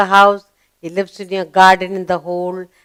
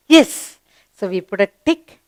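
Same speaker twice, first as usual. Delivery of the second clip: normal talking voice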